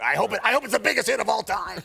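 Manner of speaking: acted burst of big hope; fervent enthusiast. Imitating a racing fan rooting for the movie, fervent. Acting out Newman's racing zeal.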